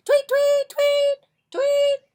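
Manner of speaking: piercing high, loud tweets; shrieked whistle blasts; police whistle imitation. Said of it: "blowing his whistle".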